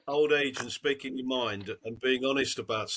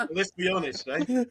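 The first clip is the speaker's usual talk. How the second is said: laughing; 7 semitones higher than usual. Real, not acted. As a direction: still cracking up at the letter's ending. Laughing after punchline.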